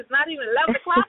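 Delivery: ordinary delivery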